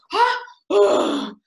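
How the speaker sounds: high, loud gasping sound; loud high mock gasp; imitated gasp. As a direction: gasp sharply like the noise from the trailer. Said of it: "like, this sound, right?".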